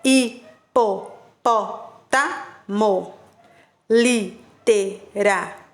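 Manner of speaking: slow, syllable-by-syllable reading; syllables drawn out. Shown as a demonstration. Teacher showing polysyllabic examples, slow and segmented. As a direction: spell each word out by its syllables. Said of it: "E aí tem o exemplo".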